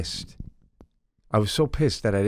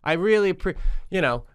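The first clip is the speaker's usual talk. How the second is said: excited; 13 semitones higher than usual. Real, not acted. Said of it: "excited rant, high pitch".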